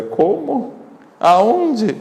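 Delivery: high-pitched, taken-aback question; incredulous. Retold or imitated. Missionary replays the reply to a request for a vestment, incredulous. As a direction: imitate a baffled reply, pitch leaping up. Priest's startled reply in retold exchange.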